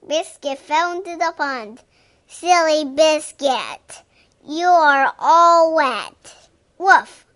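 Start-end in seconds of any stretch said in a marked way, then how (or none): 2.3-6.5 loud, slow teasing scold; playful scolding. Acted. Scolding wet puppy fondly. 6.8-7.3 high loud woof; loud dog bark. Pretend. Wet dog barks.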